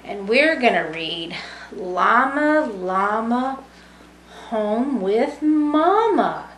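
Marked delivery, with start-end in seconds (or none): none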